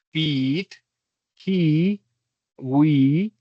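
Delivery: overdone teaching demo of the long EE; exaggerated long EE. Shown as a demonstration. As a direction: stretch the EE in "he" and "we" on purpose. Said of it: "Yo estoy exagerando un poquito".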